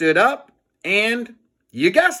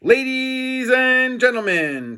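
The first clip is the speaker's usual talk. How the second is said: slow, grand announcement, pitched up; announcer voice; 10 semitones higher, slower than usual. Acted. George addressing the whole school at the microphone, grand. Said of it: "said George".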